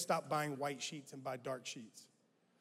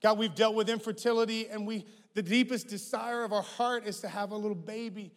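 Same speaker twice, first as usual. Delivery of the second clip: loud, higher, aching plea; pleading couple; 5 semitones higher, 7 dB louder than usual. Retold or imitated. A couple begging God for a child, loud plea. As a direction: cry out for a baby.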